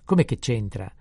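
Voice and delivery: loud, falling comeback; indignant retort. Acted. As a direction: throw the question back loudly. Priest indignantly throws question back.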